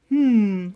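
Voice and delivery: loud, pleased sighing hum; long contented "hmm"; Bear. A narrator's reading. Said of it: "sighed Bear, quite pleased".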